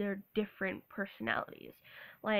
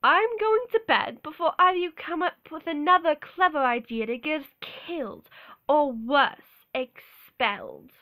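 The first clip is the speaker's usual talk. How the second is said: loud, theatrical British indignation; hammed-up British Harry Potter quote; British scolding, slowing hard on the last word; British accent; 3 semitones higher, 6 dB louder than usual. Shown as a demonstration. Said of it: "show you British accent".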